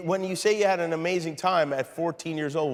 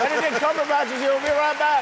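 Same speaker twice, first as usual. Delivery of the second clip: show host announcement; 12 semitones higher, 6 dB louder, faster than usual. Real, not acted. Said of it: "host's break announcement".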